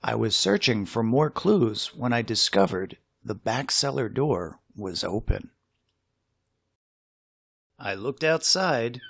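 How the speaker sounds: plain reading voice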